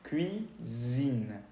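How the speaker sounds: "Cuisine" drawn out as kɥi, then zzine. Shown as a demonstration. Teacher explains the lone middle S, voiced as Z. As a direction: slowly buzz the S in "Cuisine". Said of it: "se pronuncia como una Z".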